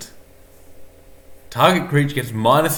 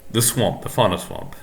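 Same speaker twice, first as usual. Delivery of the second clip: normal talking voice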